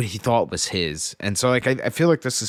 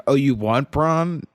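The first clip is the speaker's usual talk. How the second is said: higher, louder, drawn-out mock question; slow, sarcastic retort; 6 semitones higher, 5 dB louder, slower than usual. Podcaster retells Tyrion's needling of Pod, sarcastic. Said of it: "his sarcasm was wasted".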